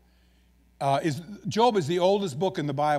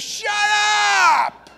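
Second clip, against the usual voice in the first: long, high, drawn-out holler; exasperated yell; 13 semitones higher, 6 dB louder than usual. Acted. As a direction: scream it over thousands of donkeys. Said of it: "just wanna yell and say".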